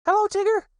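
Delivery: put-on very high little child's voice; eager small Roo; high excited question. Roo greets.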